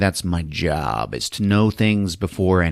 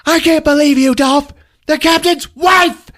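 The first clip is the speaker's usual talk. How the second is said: much higher, loud mock outburst; hammed-up angry shout; 8 dB louder than usual. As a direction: scream it at him, scorned. Diane yells at Dolph.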